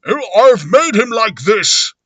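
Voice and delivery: weird voice, high and loud; slow, strange character voice, pushed up high; Invisible Man. Acted. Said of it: "now the Invisible Man, weirdly".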